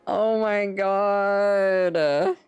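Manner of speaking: high, loud and slow; stunned oh my god. Real, not acted. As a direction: drag it out in disbelief. Shocked by gifted subs.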